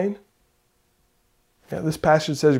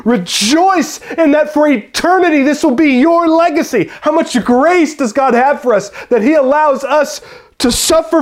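loud, high, ringing preacher's voice; impassioned sermon climax; full-force preaching; 11 semitones higher, 12 dB louder than usual. Acted. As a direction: preach it out to the whole room.

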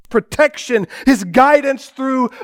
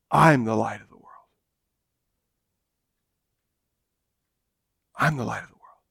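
hushed solemn Jesus; 13 semitones lower, 10 dB quieter, faster than usual. Acted. Preacher quoting Jesus to congregation, hushed and low. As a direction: speak it like a sacred secret.

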